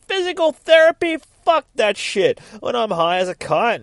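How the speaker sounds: very high, loud incredulous shriek; outraged yelling. Acted. Grumpy patient rejecting physical therapy, outraged yelling. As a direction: explode in scornful disbelief. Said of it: "starts yelling at her".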